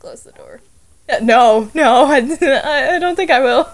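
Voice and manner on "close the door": urgent whisper. Real, not acted. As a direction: hiss it under your breath. Urgent aside to friend.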